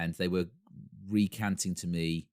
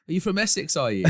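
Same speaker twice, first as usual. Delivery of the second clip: mock shout to crowd; 7 semitones higher, 6 dB louder than usual. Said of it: "were shouting to the crowd going".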